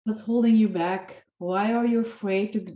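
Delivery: ordinary delivery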